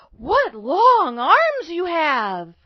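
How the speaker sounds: high, loud, very drawn-out wonder; astonished little girl. A narrator's reading. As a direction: marvel slowly as a little girl. Girl astonished at wolf's arms.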